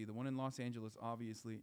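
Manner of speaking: ordinary delivery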